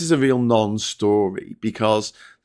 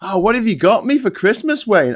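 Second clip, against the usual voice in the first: high, sing-song put-on voice; squeaky mocking skit voice; playful high caricature; 10 semitones higher, 5 dB louder than usual. Mock dialogue with Wayne.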